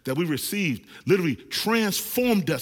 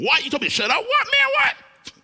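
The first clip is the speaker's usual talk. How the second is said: much higher, louder indignant squawk; mock offended street guy; 7 dB louder than usual. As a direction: play the guy taking offence. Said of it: "that gets it really going".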